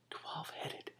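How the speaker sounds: awed whisper. Real, not acted. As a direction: breathe out "twelve-headed" in awe. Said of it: "amazed whisper".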